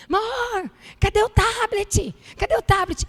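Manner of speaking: husband calling loud and high from the flat; husband shouting for the tablet; retold yelling. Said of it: "E ele gritando".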